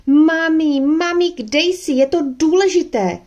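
high, loud piercing call; shouting with all her might. A narrator's reading. Girl shouting for her mother with all her might, piercing. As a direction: scream it through the whole cottage. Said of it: "zakřičela znovu ze všech sil".

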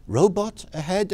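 confused, rising question; puzzled question. Retold or imitated. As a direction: ask it baffled, high pitched. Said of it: "I say".

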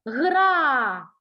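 Гра with a long, loud А; emphatic, lengthened Гра for a pupil; stretched word. Shown as a demonstration. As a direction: draw out the vowel in Гра. Teacher gives word to hunt А.

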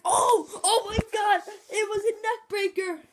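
loud, high play-by-play shout; excited outburst. Real, not acted. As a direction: cry out at a shocking wrestling move. Commentator reacts to cats' tackle.

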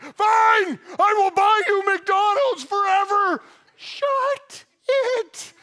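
very high, very loud cracked shout; snap, fully broken down by the begging; exasperated yell. Retold or imitated. Worn-down parent giving in to kids, exasperated shout.

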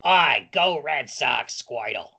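slow exaggerated Boston accent. Shown as a demonstration. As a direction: ham up a Boston local. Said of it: "worst Boston accent".

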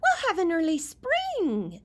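high, loud, squeaky little porcupine. Acted. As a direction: chirp it out like a tiny porcupine. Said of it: "said Penelope Porcupine".

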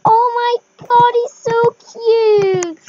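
high, very drawn-out adoring squeal; slow, loud gush of delight. Real, not acted. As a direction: stretch every word, melting over something cute. Gushing over the new horse.

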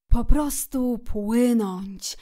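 slow, drawn-out reverie; dreamy. A narrator's reading. Szczur lost in thoughts of boating, dreamy. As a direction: stretch it out dreamily. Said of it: "ciągnął dalej rozmarzony".

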